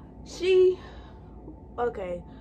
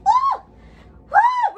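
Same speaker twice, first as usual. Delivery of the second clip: high, loud squealing woo; real thrilled whoop; excited whoops; 10 dB louder than usual. Real, not acted. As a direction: scream with giddy delight.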